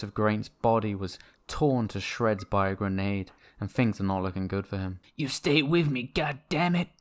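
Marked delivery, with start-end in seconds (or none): 5.2-6.9 high desperate medic plea; hammy death scene. Acted. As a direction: plead over a dying friend. Acted death scene, medic shouting.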